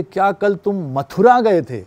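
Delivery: Mathura punched, the rest falling away; Mathura as the peak of the question; stress on Mathura. Shown as a demonstration. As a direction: lean hard on Mathura, questioning the place. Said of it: "Mathura पे stress कर दिया".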